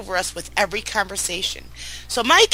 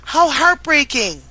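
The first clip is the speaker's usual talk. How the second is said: heartbroken; 6 semitones higher, 4 dB louder, slower than usual. Real, not acted. Reacting to son's insult.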